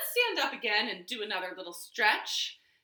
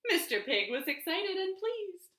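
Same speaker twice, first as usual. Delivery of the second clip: ordinary delivery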